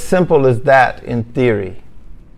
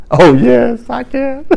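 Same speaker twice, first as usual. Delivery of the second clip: higher, giddy, starry-eyed yes; eager buyer; 9 semitones higher, slower than usual. Acted. Buyer answering the salesman, higher giddy voice. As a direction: gush like a dazzled customer.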